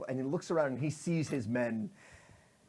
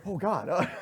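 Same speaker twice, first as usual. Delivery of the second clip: dismayed gasp; 5 semitones higher, 6 dB louder than usual. Acted. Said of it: "she's like".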